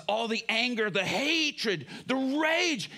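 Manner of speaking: rising, intense list in a high voice; exultant release. Retold or imitated. Freed prisoner exulting in release.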